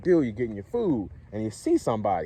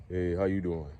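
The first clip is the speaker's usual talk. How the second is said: low, soft greeting with falling pitch; down-talking; 5 semitones lower, 7 dB quieter than usual. Shown as a demonstration. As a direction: let "how you doing" sink down low. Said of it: "the pitch goes down like that".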